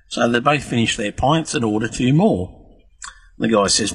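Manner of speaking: ordinary delivery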